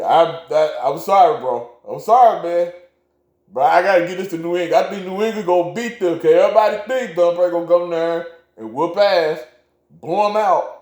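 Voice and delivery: high, loud and worked up; animated apology. Real, not acted. Defending an upset pick to cohost.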